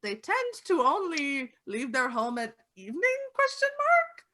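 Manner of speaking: lightly voiced old lady note, rising on questions; reading the old lady's note. GM reads out the old lady's suspicious note in mild old lady voice. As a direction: read her fussy note aloud in a mild old lady voice. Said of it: "It says, like".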